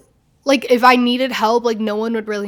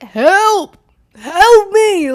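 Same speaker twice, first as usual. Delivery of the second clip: loud, high-pitched cry for help; acted-out yell for help; calling for help; 9 semitones higher, 9 dB louder, much slower than usual. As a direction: call out for rescue through a locked door. Retold call for help through door.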